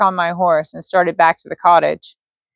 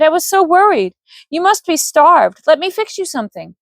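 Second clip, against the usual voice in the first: worried woman voice; 9 semitones higher than usual. Acted. Vivian fussing.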